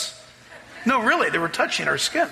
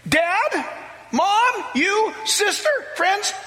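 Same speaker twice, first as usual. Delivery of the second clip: much higher, urgent ringing call; fervent plea; 12 semitones higher than usual. Retold or imitated. New convert Kaha urging his family to accept Christ, much higher fervent shout. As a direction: plead with the room to come now. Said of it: "He said".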